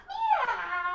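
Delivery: high mewing cat sound; high playful meow. Shown as a demonstration. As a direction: meow like a kitty for kids. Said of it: "Let's be cats".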